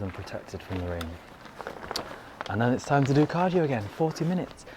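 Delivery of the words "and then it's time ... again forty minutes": ordinary talk, nothing marked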